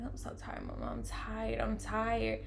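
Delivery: very soft, drained murmur; small, faint complaint to mama; retold weary plea. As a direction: imitate yourself too tired to speak up. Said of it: "I'm, like, talking to my mom".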